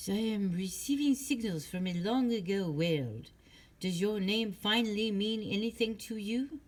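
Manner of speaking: lightly voiced French psychic, dreamy; mystic medium. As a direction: channel a faraway world. Fortune teller channels signals.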